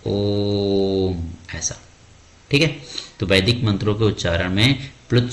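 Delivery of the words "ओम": ओम with the ओ held very long; long, slow, held ओम; drawn-out Om. Shown as a demonstration. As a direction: chant ओम, stretching the vowel. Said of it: "जैसे ओम बोलते हैं".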